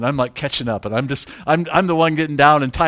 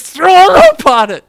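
very high, loud shout; urgent retold yell; 12 dB louder than usual. Friend dodging cow to roper, urgent yell. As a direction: imitate the friend yelling an order. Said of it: "and says".

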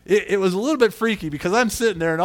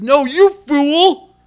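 much louder and higher, slow stern rebuke; strong rebuke; 11 semitones higher, 12 dB louder, much slower than usual. Acted. Preacher voicing God rebuking the rich fool, loud slow rebuke. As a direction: thunder it out as God scolding. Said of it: "That was kinda strong".

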